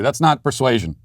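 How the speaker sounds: usual speaking voice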